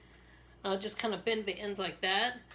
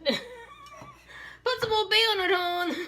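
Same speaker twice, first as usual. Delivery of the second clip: slow, much higher Baltimore hon voice through laughter; laughing Maryland accent; 11 semitones higher, much slower than usual. Retold or imitated. Joking to viewers about Old Bay, laughing Baltimore accent. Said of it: "You know you're a Maryland girl when".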